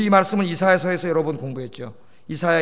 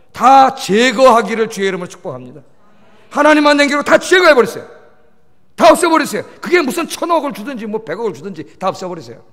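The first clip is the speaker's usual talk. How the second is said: loud, higher-pitched commands from the pulpit; forceful preaching; 3 semitones higher, 6 dB louder than usual. Real, not acted. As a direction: preach it hard, punching the imperatives. (힘주어 외치는 설교)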